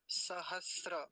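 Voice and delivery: सहस्र as sa-has-ra, no t; correct model. Shown as a demonstration. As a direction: hold the s before ra in सहस्र. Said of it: "ऐसे".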